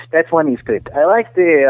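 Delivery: normal talking voice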